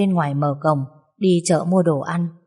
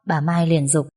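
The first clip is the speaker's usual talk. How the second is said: ordinary delivery, nothing marked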